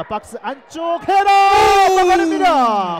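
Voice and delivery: loud, high play-by-play yell; excited shout. Real, not acted. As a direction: shout the save as it happens. Live save on a header.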